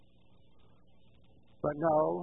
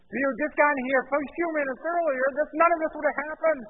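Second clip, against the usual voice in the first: high, loud, accusing excuse; blaming Samuel; 9 semitones higher, 9 dB louder than usual. Acted. Saul blames Samuel for being late, accusing. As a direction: protest hotly that it is his fault. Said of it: "so he blames Samuel".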